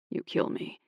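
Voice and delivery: whispered tenderly; soft whisper. Acted. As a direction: breathe it softly to her. Tender aside, unvoiced.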